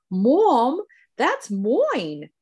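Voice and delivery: mother imitating her young daughter's British accent. Mother imitating her daughter's odd British accent. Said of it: "she's almost speaking British".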